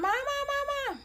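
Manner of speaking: high-pitched mock yell for mom; imitated child's screaming. Little girl screaming for her mom, high mock scream. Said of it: "she started screaming for her mom".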